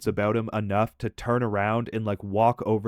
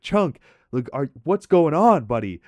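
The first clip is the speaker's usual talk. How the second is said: higher, louder alarmed call to a friend; 4 semitones higher, 6 dB louder than usual. Retold or imitated. Narrator calling to his friend after he vomits, alarmed loud call. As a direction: call out to him in panic.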